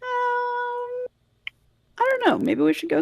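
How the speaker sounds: high squeaky flustered voice. Acted. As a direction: squeak it out, caught off guard. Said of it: "I liked how squeaky your voice got".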